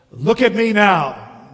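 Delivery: a touch of bluster; boastful ghost. Acted. Ghost showing himself off to the bright man, boastful.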